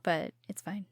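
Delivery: whispered aside. Real, not acted. Teasing aside.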